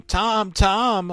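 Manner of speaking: high desperate screams of the name; screaming. Retold or imitated. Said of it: "He was just screaming".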